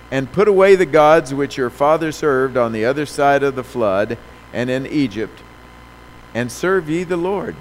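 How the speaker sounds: loud, rousing scripture read; preaching. Acted. Preacher reading Joshua and calling the church to answer, loud. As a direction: drive the verse home to the congregation. Reads Joshua, calls for response.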